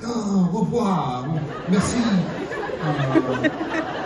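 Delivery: high, slow, laughing fake French; a laughing parody of French lovers yelling; mock passionate French. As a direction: ham up a lovers' quarrel in French. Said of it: "they're just yelling very passionately".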